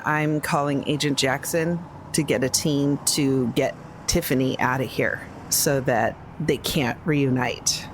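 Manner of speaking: normal talking voice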